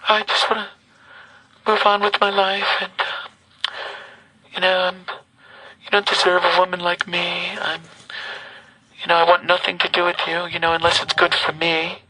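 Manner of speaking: tinny recorded phone message; like a voicemail played back; answering machine message. Real, not acted. Playing the woman's voicemail.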